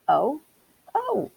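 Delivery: a high, startled little mouse cry; cried out. Acted. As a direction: let it jump up high in dismay. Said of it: "cried Timmy Willie".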